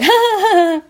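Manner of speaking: high, loud mock laughter; exaggerated high ha-ha laugh; imitated laugh. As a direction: mimic the niece cackling, high and loud. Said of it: "laughs. She's like".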